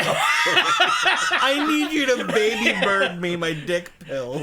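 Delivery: high, drawn-out comic holler; mock demand. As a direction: belt it out slowly, laughing through it. Acted demand at strip club.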